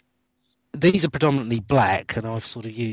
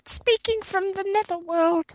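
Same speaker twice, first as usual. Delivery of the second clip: Liz Taylor; slower than usual. Acted. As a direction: squeal it out, way up high. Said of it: "my very own Liz Taylor".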